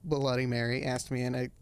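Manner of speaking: usual speaking voice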